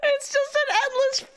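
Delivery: high wailing laugh-cry; laughing so hard it breaks into a wail. Real, not acted. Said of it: "my chat is a hundred percent wah".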